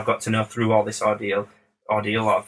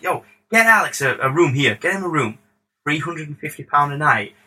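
higher-pitched star ordering casually, lightly imitated; 4 semitones higher than usual. Star telling manager to book a room, bad impression. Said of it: "This is a really terrible [name] accent".

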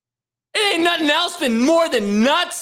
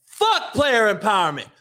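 ordinary talk

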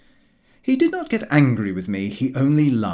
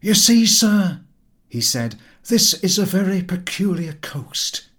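put-on genial old sailor; 7 semitones higher, 6 dB louder than usual. A narrator's reading. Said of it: "kindly geniality returned".

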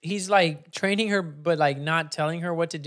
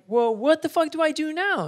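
exasperated; 12 semitones higher than usual. Retold or imitated. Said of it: "she's like".

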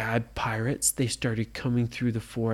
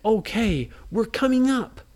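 excited call; 9 semitones higher, 4 dB louder than usual. A narrator's reading. Kids calling up from hole.

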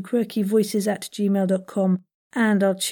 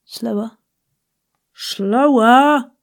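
ever slower; 2 semitones higher, 6 dB louder, much slower than usual. Retold or imitated. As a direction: coax them to drag it out. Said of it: "She says".

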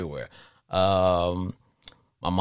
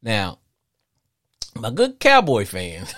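ordinary talk

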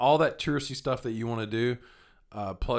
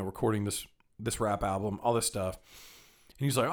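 usual speaking voice

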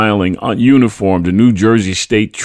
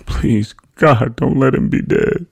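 higher-pitched plea at ordinary volume; mumbled pleading prayer; 7 semitones higher, slower than usual. A narrator's reading. Wife prays that he is alive, mumbled pleading. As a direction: plead anxiously to God. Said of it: "She prayed aloud".

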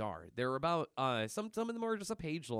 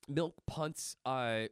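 usual speaking voice

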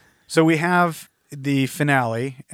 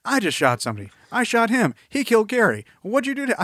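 panicked henchman; 10 semitones higher, 4 dB louder than usual. Acted. Acting out the panicked shooting scene.

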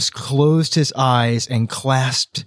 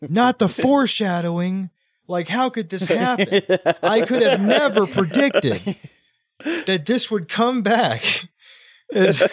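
laughing; 8 semitones higher than usual. Real, not acted. Laughing at obvious foreshadowing.

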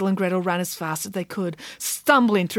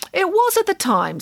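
high-pitched pig character; perky high voice; Nanny Piggins voice; 10 semitones higher than usual. A narrator's reading.

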